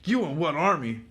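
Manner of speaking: high, loud cocky taunt in a put-on voice; tough character taunt. Voicing a comic character taunting his foe, high and loud. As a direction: bark the challenge like a brawler. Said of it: "He's like".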